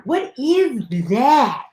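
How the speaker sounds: a slow, mock-baffled double take; slow drawn-out question. Host tasting a drink, asking again slow and drawn out. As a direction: stretch every word out, slow and puzzled.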